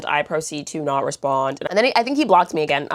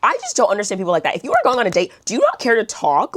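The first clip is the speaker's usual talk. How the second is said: normal talking voice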